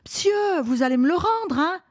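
put-on high, urgent kid's voice; high-pitched pleading youngster; anxious child. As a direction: plead like a little boy wanting his thing back. Child grabs hand, begs book back.

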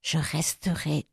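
put-on dog whispering beside the sleeping girl; whispered promise. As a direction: breathe a tender vow. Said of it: "murmura-t-il".

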